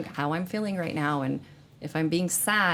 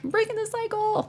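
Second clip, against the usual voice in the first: very high-pitched sing-out; playful self-quote. Retold or imitated. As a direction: declare it in a tiny, high mock voice. Quoting herself, playful mock declaration.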